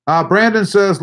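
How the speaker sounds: usual speaking voice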